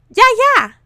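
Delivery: very high, loud squeal; baby squeal. A narrator's reading. Baby Peter cheering the welcome, high loud squeal. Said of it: "Peter squealed".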